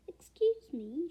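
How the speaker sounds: lightly voiced sweet old woman; bright. A narrator's reading. Kind old woman at the door, brightly. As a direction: chirp it politely. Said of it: "she said brightly".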